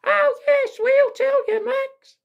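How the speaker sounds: very high mock children's chorus; panto children. Children promising to warn Buttons, in very high kiddie voices. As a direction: squeal it like an audience of kids.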